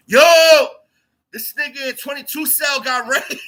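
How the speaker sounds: high, strained mock yell breaking into laughter; calling through vent. Inmate spreading news on the vent, high mock holler. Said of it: "motherfuckers is, um, on the vent".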